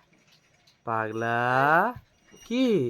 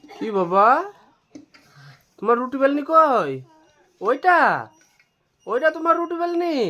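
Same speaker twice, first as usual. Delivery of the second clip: ordinary talk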